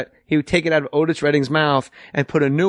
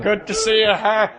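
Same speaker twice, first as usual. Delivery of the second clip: higher, chummy handshake greeting; fifties movie glad-hander; 5 semitones higher than usual. Retold or imitated. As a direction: hand out cigars like a proud new dad in an old film. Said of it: "kind of like in a nineteen fifties movie".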